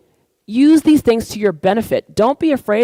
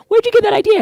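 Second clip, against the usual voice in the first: much higher, louder mock suspicion; imitating suspicious mom; 14 semitones higher, 4 dB louder, faster than usual. Mom suspicious of her daughter's lasagna idea, high mock squeak.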